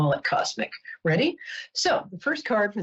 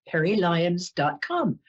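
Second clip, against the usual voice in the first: ordinary talk, nothing marked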